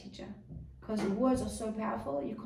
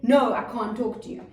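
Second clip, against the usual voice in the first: louder, shutting-out "No"; blunt refusal; 6 dB louder than usual. Shown as a demonstration. Parent shutting a child out, blunt refusal. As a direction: refuse outright, cutting off the talk. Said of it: "You can't say".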